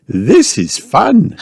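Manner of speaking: high, slow delighted mother; high storybook mum having fun; Mummy Pig. A narrator's reading. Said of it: "she said".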